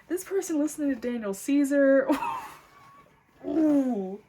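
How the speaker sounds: loud, high outburst with drawn-out oohs; worked-up exclamation. Real, not acted. Reader reacts to someone's music taste, worked-up exclamation. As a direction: exclaim it loudly, stretching the oohs.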